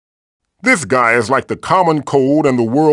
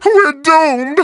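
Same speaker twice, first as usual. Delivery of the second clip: panicked wail. Acted. Idle line, panicked wail.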